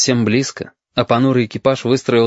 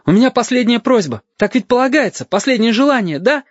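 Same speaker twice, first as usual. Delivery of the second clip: put-on high-pitched boyish pleading; urgent plea; 13 semitones higher than usual. A narrator's reading. Condemned boy asks for a last request, high and urgent.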